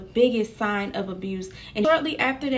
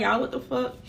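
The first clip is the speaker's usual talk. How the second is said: usual speaking voice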